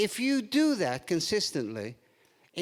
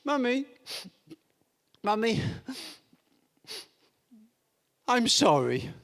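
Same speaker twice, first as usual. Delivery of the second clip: lightly acted contrite little boy; mock-sorry, with long pauses; wheedling child; 3 semitones higher than usual. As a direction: apologise haltingly to win mum over. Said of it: "he tries another tack".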